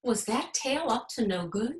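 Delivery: ordinary delivery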